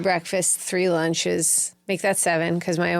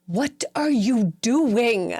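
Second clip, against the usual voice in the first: sharp demand; 5 semitones higher, much slower than usual. Retold or imitated. Mother to screaming daughter, a sharp slow demand. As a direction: stop her cold with a sharp question. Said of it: "I grabbed her by the shoulders and I was like".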